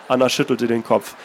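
calm, measured reading of a novel; story narration. A narrator's reading. Author reading his novel aloud, steady narration. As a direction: read the passage steadily, letting it speak.

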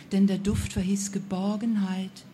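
usual speaking voice

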